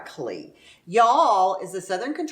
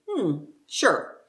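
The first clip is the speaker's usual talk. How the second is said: unconvinced, humoring them; skeptical hmm sure. Retold or imitated. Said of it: "It's a friendly way of saying".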